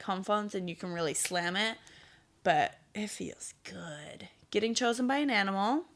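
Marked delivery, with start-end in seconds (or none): 2.4-4.3 whisper. Real, not acted. Savouring slamming the phone down.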